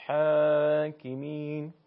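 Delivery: حاکمین with throaty ح and thin, open long ā; very slow recited حاکمین, alef kept light. Shown as a demonstration. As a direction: stretch حا in حاکمین without rounding the vowel. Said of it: "بلکه به صورت".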